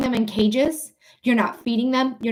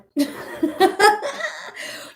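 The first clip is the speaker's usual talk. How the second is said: high, drawn-out chuckle; amused laugh at your own remark; 9 semitones higher than usual. Real, not acted. Streamer laughing about griping at the government, high chuckle.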